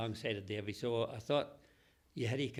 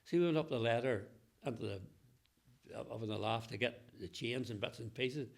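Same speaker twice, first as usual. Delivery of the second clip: ordinary talk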